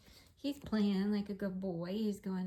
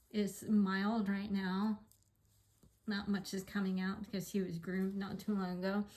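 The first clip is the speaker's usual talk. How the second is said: normal talking voice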